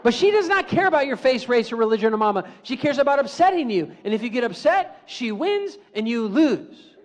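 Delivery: loud, rising lecture voice; emphatic preaching. Real, not acted. Psychologist drives the lesson home to the crowd, loud. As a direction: hammer the point home.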